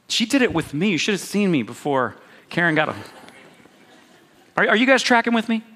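usual speaking voice

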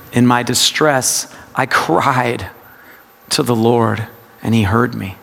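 CRIED leaned on, then a pause; low meditative reading, weight on CRIED; stress on CRIED. Shown as a demonstration. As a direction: hang on "cried" before continuing. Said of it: "it's a practice of word emphasis".

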